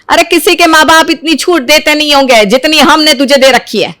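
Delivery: normal talking voice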